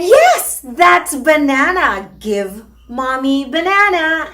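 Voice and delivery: squealing high, loud praise that eases into a slow, bright ask; exaggerated rewarding praise. Shown as a demonstration. Mother cheering her toddler for touching the banana, exaggerated high praise. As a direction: overdo the praise like the child just won a prize. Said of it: "make a really exaggerated, rewarding voice saying".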